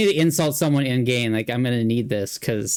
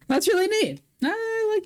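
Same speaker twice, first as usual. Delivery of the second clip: excited. Real, not acted. Delighted reaction, pitch jumps.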